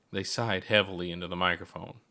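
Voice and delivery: usual speaking voice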